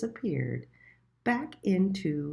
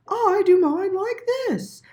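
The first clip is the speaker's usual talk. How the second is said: high and loud, proud; showing off her way; cow voice; 10 dB louder than usual. A narrator's reading. The cow showing Little Mole its way, high and proud.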